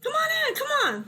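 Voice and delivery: very high-pitched uncle voice; high, hurrying invitation; welcoming uncle. Acted. Uncle ushers the visitors inside.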